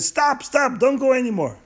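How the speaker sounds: high, urgent call to halt; calling out to stop a rider on the road. Acted. Stranger halts Zundel's wagon.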